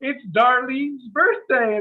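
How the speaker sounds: retold yell. Waiter announcing the birthday to the restaurant, mock yell up high. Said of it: "he yells in the room".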